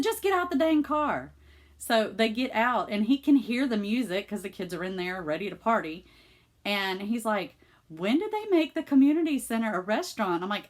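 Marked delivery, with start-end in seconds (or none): 0.0-1.3 raised-pitch exasperated retort; exasperated urging. Retold or imitated. Daughter telling dad to leave the car, exasperated. 7.9-10.4 higher, mock-baffled questioning; puzzled dad. Dad questioning daughter about the community center, mock-puzzled.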